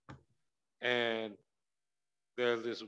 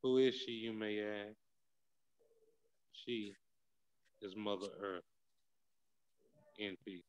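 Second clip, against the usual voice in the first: poem recital; 4 dB quieter than usual. Acted.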